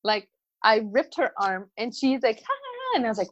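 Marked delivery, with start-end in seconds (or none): 2.4-3.0 high mock giggle, softer; mock child laugh. Mom imitating daughter laughing, a mock giggle. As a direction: imitate a toddler's carefree laugh. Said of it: "she was still laughing".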